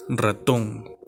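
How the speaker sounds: "Ratón" with TÓN as the strong syllable; stress on last syllable. Shown as a demonstration. Teacher presenting an example word, stress on final TÓN. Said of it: "su mayor fuerza de voz está en la última sílaba".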